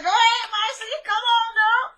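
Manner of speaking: very high, squealed egging-on; excited cheering on. Retold or imitated. Viewer eggs Marcy on from home, high excited squeal.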